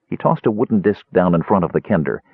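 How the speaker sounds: ordinary delivery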